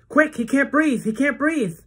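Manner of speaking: high frantic yell for help; panicked shout. Acted. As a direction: scream for the nurse. Running for the nurse.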